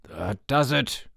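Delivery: slow, growled-out warning; slow growl through a tight throat. A narrator's reading. Chip has had enough of the boy, growled. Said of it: "growled Chip".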